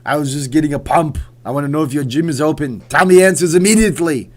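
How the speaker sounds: mock phone call in cartoon Austrian accent; Arnold. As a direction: play the governor ringing up, building to a loud demand. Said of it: "Should have called Caesars with Arnold's voice".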